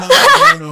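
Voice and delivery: sudden high laugh; high giggle. Real, not acted. Laughs at spooked teacher.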